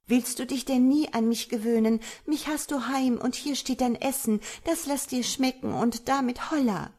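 high-pitched, bossy wife; brisk high voice, fussing the ogre to his dinner; wife, scolding. A narrator's reading. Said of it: "sprach die Frau". (resolute Ehefrau mit hoher Stimme, schimpfend)

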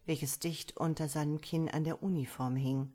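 ordinary delivery, nothing marked

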